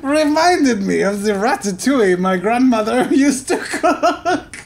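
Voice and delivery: French accent, cracking up mid line; laughing through French accent. Real, not acted. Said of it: "retire the horrible fake French accent".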